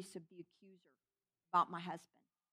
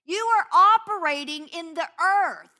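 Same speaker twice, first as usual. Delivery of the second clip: loud, passionate preaching as God, higher and louder; God's voice; 6 semitones higher, 10 dB louder than usual. Retold or imitated. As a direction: thunder God's urgent plea to come back to love. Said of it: "And he's like".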